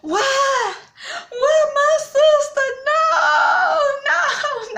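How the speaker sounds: very high, loud imitated wail; drawn-out, hammed-up crying shriek; hysterical wailing. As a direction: mimic a sister collapsing in hysterics. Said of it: "she fell to the ground".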